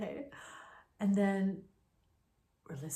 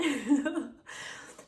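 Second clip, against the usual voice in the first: short laugh; 6 semitones higher than usual. Real, not acted. Laugh after retelling the fear.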